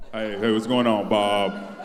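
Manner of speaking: very low, drawn-out man's voice; put-on deep manly voice; mock deep voice. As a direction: drop right down to a deep bass greeting. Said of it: "I bet you don't even talk like that".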